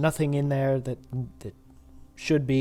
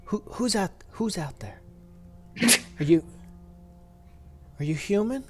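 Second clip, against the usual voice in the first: timid, higher, hesitant voice; lightly voiced scared survivor; meek voice; 6 semitones higher than usual. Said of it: "very sort of meek voice".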